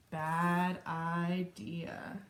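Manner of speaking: very slow, deliberate read-aloud; drawn-out verdict. A narrator's reading. Rosie notes the failed kitchen test, slow verdict. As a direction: stretch each word right out.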